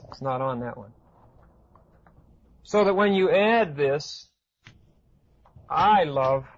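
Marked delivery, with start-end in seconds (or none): 5.7-6.4 "I" leaned on, set apart from "love"; demonstrative stress on "I"; emphasis on I. Shown as a demonstration. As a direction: put the weight on "I" rather than "love". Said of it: "the emphasis is come, switches to this".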